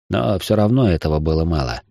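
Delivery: ordinary delivery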